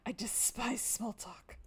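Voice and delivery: slow, whispered sneer with a laugh; a haughty cat hissing its disdain, slow and whispered; disdainful whisper. Acted. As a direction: breathe it out with contempt, slowly.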